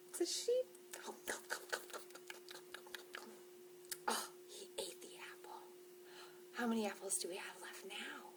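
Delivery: hushed gasping whisper. Acted. Librarian whispering the sheep reveal to kids. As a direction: whisper it with a little gasp.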